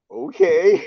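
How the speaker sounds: very high, drawn-out doubtful okay; humoring. Retold or imitated. Student humoring his teacher's ghost story, doubtful. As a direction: play along, voice lifted way up. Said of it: "kinda humoring him".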